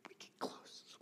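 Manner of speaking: soft unvoiced whisper; preacher demonstrating a whisper to the congregation. Shown as a demonstration. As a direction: lean in and whisper it. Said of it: "And we whisper quietly".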